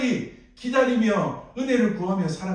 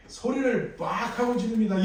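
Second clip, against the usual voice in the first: normal talking voice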